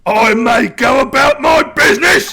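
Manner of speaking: booming, high-pitched warlord shout; orc warlord. Acted. Warlord taunting the captured player, shouting. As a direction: bellow the threat like a mad orc chief.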